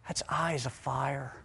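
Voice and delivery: low, hushed, almost to himself; hushed awe. Acted. Preacher hushed before the big reveal. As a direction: say it softly, letting it sink in. Preacher names Jesus' fiery gaze.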